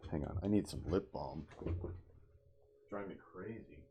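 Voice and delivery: plain reading voice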